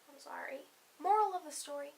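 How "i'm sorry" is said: whispered apology. Real, not acted. Apology to pet.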